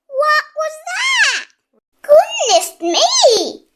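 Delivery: startled cry. A narrator's reading. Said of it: "she cried".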